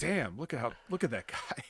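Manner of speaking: low, quiet aside of awe; hushed awe. Retold or imitated. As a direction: drop your volume as if privately stunned. Retold awed reaction to his size.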